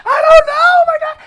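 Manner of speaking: shrieking high and fast with a laugh breaking in; dramatic panic. Retold or imitated. Coming out to mom at dawn, dramatic high laughing shriek. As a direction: overblown hysterical wail, rushing the words. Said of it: "They so dramatic".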